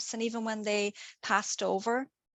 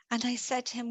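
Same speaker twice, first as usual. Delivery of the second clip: ordinary talk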